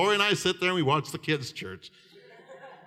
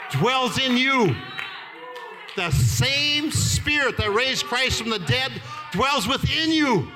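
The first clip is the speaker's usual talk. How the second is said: high, forceful revival preaching; stirring revival sermon, pitched high; fervent preaching; 6 semitones higher than usual. Real, not acted. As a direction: preach it with full force, driving every phrase.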